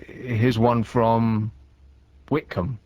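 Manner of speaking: "Here's one from" drawn out slowly; mock-earnest slow quote of "Here's one from"; quoting his own catchphrase. Shown as a demonstration. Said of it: "when I say".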